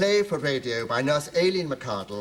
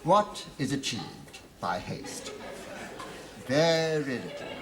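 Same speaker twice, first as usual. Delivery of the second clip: preaching. Acted. Vicar's slow sermon.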